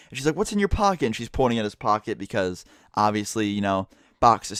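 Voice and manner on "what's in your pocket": mock yell. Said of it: "starts yelling even louder now".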